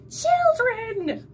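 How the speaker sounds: high, loud troll shriek; troll shrieking at the intruding children. A narrator's reading. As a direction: shriek it in disgust. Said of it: "shrieked the troll".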